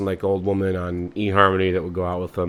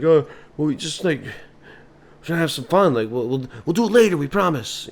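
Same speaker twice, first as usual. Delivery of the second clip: higher, pleading; whiny kid; 8 semitones higher, 3 dB louder than usual. Acted. Kid pleading with father, higher. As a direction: promise to do it later.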